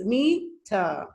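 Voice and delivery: METER split into two separate beats; syllable demo. Shown as a demonstration. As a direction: sound out METER as me, then ter. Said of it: "that is two syllables".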